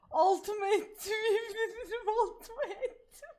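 wheezing through laughter; laughing. Real, not acted. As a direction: laugh while confessing. Helpless laughter.

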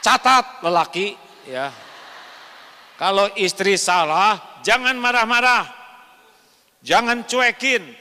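loud, high exhortation; forceful preaching. Real, not acted. Preacher booming at husbands in the congregation, loud and high. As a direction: drive the command home at full force.

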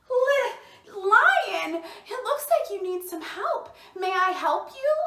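high, excited mouse voice; concerned little mouse. A narrator's reading. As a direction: pipe up offering help to the lion. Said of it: "Little Mouse came back".

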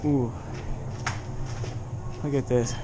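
ordinary talk, nothing marked

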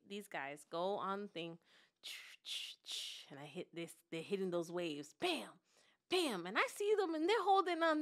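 ordinary talk, nothing marked

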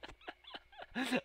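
very high wheezing laugh; squeaky burst of laughter; high giggle. Real, not acted. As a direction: crack up at your own joke. Laughs at own joke.

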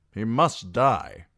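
loud, slow, hard verdict; insistent demand. A narrator's reading. Knight presses for execution, loud and insistent. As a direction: insist on death with full force.

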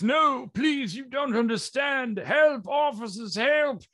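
very high-pitched, hammed-up panic; mock-terrified squealing for rescue; pleading cry for help. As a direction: plead shrilly like a cartoon victim. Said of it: "He says".

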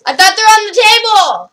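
very loud, high yell; flustered shout. Real, not acted.

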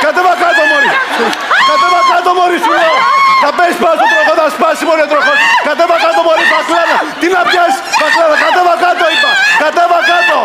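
high-pitched, panicked orders; frantic scolding. Pretend. Host orders contestant off the wheel, frantic scolding. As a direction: order them down urgently, voice pushed up high.

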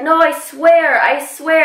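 high, loud imitated pleading; imitating his earnest insistence; insistent promise. Retold friend insisting he'll remember.

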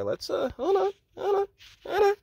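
high, whining, crying-edged voice; flustered high whine; mock whiny hold on. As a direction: whimper it like you're overwhelmed. Joking over sexy leader photo.

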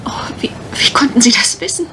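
quick, stunned whisper, tripping over the words; astonished whisper. Acted. Miss Dean to the visitor who guessed her secret, fast whisper. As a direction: hush it out fast, amazed she was seen through.

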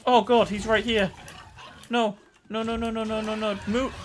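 high frantic cries of no; begging the monster away in a panic; panicked. Real, not acted. Player sees the monster close in, crying out high in panic. Monster right on top of them.

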